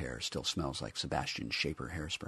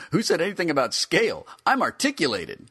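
high, loud indignant figure; indignant toy voice; 11 semitones higher, 8 dB louder than usual. Acted. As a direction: snap back defensively. Figure retorts.

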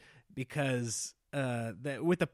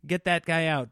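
mock president; 6 semitones higher, 5 dB louder than usual. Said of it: "if they think".

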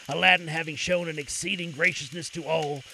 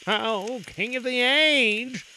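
slow, grave, higher courtier voice; oily scheming vizier; 5 semitones higher, much slower than usual. A narrator's reading. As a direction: speak gravely with hidden malice. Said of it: "replied the Vizier gravely".

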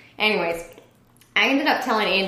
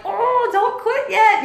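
much higher, slow, soothing mock husband; husband's calm reply; 6 dB louder, slower than usual. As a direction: slowly talk her down, gently amused. Husband's reply voiced in story.

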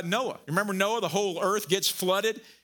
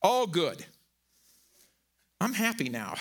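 ordinary talk

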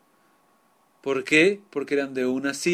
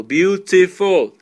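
"beautiful" stretched slowly into three loud syllables; "beautiful" broken into three syllables, higher and louder; syllable-by-syllable demo; 5 semitones higher, 9 dB louder, much slower than usual. Shown as a demonstration. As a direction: sound out "beautiful" slowly, beat by beat. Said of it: "tres sílabas".

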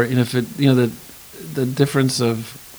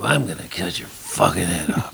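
overdone menace; 6 semitones lower, 4 dB quieter than usual. Shown as a demonstration. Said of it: "You don't have to go".

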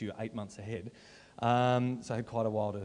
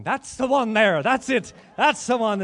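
high, loud mock leprechaun brogue; playful leprechaun voice pitched high; Irish leprechaun accent; 11 semitones higher, 14 dB louder, slower than usual. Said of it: "I got to put on my leprechaun".

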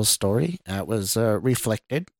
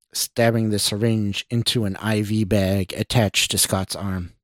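ordinary delivery, nothing marked

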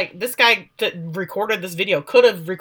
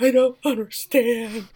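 slow, drawn-out mock bewilderment; imitated shock; 3 semitones higher, slower than usual. Daughter in shock to mother, slow mock bewilderment. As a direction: imitate someone dazed and lost. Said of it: "Andy is in shock".

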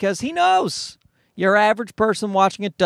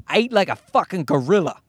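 usual speaking voice

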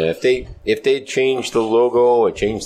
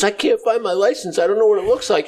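blonde in the joke; 10 semitones higher than usual. Retold or imitated. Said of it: "She goes".

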